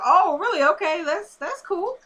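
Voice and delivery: high-pitched mock-casual reply; imitating a guy acting cool; acted feigned nonchalance. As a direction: play it high, as if unbothered. Voicing character's put-on nonchalant reaction.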